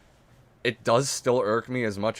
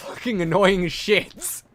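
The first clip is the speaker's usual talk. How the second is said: slow, laughing through annoyance; laughing annoyed; 8 semitones higher, 6 dB louder, slower than usual. Acted. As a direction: laugh it out, fed up.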